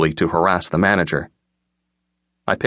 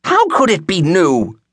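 put-on high, slow, outraged old-woman voice; incensed old woman; 11 semitones higher, 3 dB louder, slower than usual. A narrator's reading. Old woman arguing with bookstore clerk, incensed. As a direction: protest in a drawn-out, high-pitched wail. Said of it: "the incensed old woman said".

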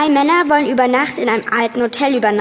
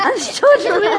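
amused repeat; 9 semitones higher, slower than usual. Real, not acted.

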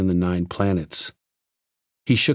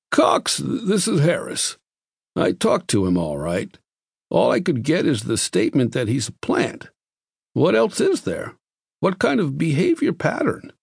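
a hint of a puzzled doctor pressing questions; Harris, higher; 6 semitones higher than usual. A narrator's reading. Harris questioning Cox over the vidphone, lightly voiced higher.

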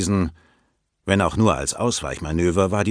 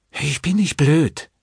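hohe, langsame Säuselstimme; säusle hoch und gedehnt; säuselnd; 8 semitones higher, slower than usual. A narrator's reading. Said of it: "säuselte sie". (süßlich säuselnde Frauenstimme)

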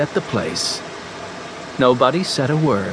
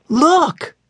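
high, louder, eager call; excited cry; 13 semitones higher, 3 dB louder than usual. Acted. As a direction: point it out with a bright cry. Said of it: "cried Violet".

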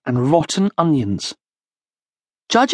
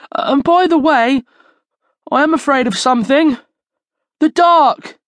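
high-pitched frightened confession; whimpering; 8 semitones higher, 4 dB louder than usual. A narrator's reading. Charlie admits to friends a fear of the dark, whimpering. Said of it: "whimpered Charlie".

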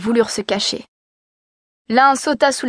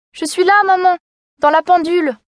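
put-on much higher, small child's voice; thin little voice; 7 semitones higher than usual. A narrator's reading. Youngest kid calling to mother from the clock, high and thin. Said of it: "une petite voix fluette".

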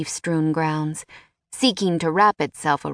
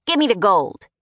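louder, insistent order; demanding; 3 semitones higher, 4 dB louder than usual. Acted. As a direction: hold out a hand and insist. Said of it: "he demanded".

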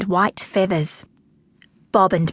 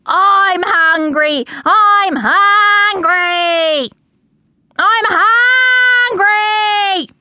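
loud, high, long-drawn wail; mock begging scream; 11 semitones higher, 6 dB louder, much slower than usual. A narrator's reading. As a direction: scream for food, stretching each word out. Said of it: "they would scream".

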